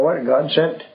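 ordinary talk, nothing marked

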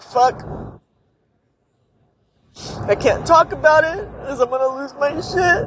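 words squeezed out through tears; crying. Real, not acted. Admitting to viewers she cannot talk about it, crying. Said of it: "I need to stop crying".

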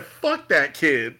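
slow, deliberate mock dismissal; blunt quote. Soldier Boy dismissing his grandson, slow and blunt. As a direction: drag each word out coldly. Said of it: "and he said".

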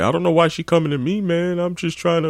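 high quick self-talk; imitating the dude muttering. Dude talks to himself after, high quick whine. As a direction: grumble to himself in a whiny voice. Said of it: "talking himself out loud like".

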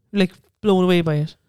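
usual speaking voice

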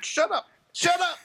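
very high-pitched imitated outburst; mock exasperated. Podcast guest acting out the film king silencing a peasant, mock exasperated. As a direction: squawk it like a fed-up king in a comedy.

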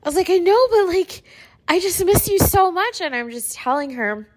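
high, strained voice close to tears; tearful, voice climbing with emotion; really teary. Real, not acted. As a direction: retell it while fighting back real tears. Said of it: "making me get teary-eyed".

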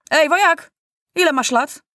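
high, loud hail to a youngster; calling out. A narrator's reading. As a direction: challenge the boy with a shouted question. Soldier hails the boy.

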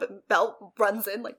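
ordinary talk

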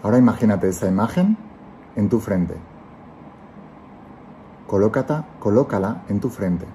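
calm guiding voice, let each phrase settle; guided meditation. Acted. Leads a guided visualization.

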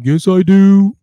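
high, loud mock protest; Marcelino protesting. Husband answering his wife, loud high protest. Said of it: "And he's like".

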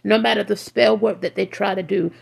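ordinary talk

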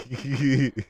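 loud, high burst of laughter; laughing. Real, not acted. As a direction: crack up at your own joke. Laughs at own joke.

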